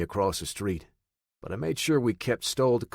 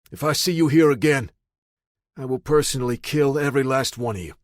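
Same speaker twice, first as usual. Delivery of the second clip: loud threat; 4 semitones higher, 6 dB louder, faster than usual. Acted. Threatening the gang.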